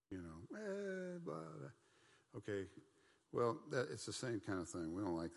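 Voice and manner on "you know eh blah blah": mock vague answer.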